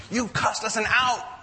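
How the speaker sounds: loud, high furious shout at the fan; angry yell. Retold or imitated. Left fielder yelling at the fan who cost the out, furious shout. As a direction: blast him for costing the out. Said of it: "he starts yelling at Bartman".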